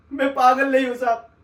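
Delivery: loud, pleading insistence; desperate protest. Acted. Insisting to the sahib he is not mad, loud protest. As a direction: shout that you are not mad.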